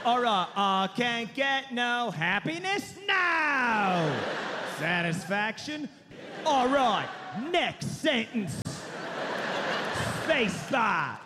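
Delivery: [name] songwriting voice. Retold or imitated. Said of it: "It's like".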